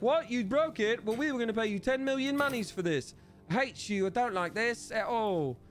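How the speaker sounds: high, whiny mock outrage; mock upset NPC. Streamer voicing an upset NPC, high whiny mock outrage. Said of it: "They're gonna be like".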